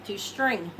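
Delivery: ordinary delivery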